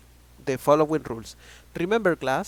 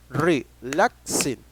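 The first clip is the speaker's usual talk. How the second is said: slow model word; 4 dB louder, much slower than usual. Shown as a demonstration. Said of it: "three syllables".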